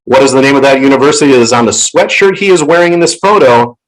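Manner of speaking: ordinary talk, nothing marked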